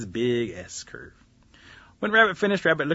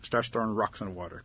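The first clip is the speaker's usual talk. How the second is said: usual speaking voice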